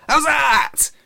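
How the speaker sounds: very high, loud cricket appeal; triumphant shout. Acted. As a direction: shout it expecting applause. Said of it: "he shouted".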